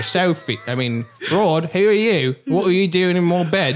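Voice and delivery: over-the-top Cockney, high-pitched; Benedict Cumberbatch. Acted. To the wife in his bed, exaggerated Cockney. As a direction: demand it in hammed-up full Cockney. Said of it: "He's gone full Cockney".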